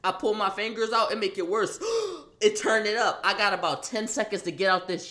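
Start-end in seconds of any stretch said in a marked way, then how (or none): 1.8-2.4 a choking gasp for air; sharp high gasp. Retold or imitated. Choked harder, a high gasp. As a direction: gasp as the hold tightens.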